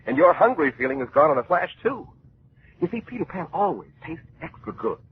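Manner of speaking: put-on cheery old-time radio announcer; radio ad read. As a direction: sell it brightly to the kids. Mid-show peanut butter commercial.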